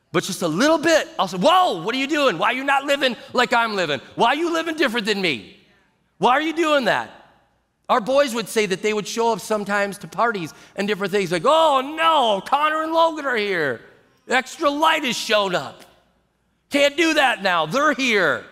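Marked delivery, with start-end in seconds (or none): 1.4-7.1 high, exclaiming questions; mock challenge. World to someone living differently, mock challenge. 11.4-18.4 high, swooping oh-no wail; hammed-up alarm, pitched well up; mock dismay. Party-goers as pastor's sons arrive, mock dismay.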